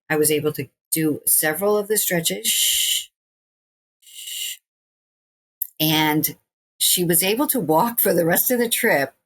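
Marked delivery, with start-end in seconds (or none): 2.5-4.6 two long hissed exhales; imitated focused breathing. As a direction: breathe out slowly through a stretch. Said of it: "she focused on her breathing".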